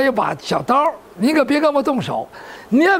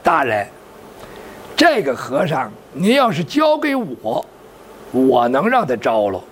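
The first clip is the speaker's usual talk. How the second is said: Zhao Bi's sly offer; 11 semitones lower than usual. A narrator's reading. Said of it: "赵壁在旁边过来了".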